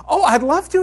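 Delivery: eager instructor. Retold or imitated. Instructor voiced in flight story.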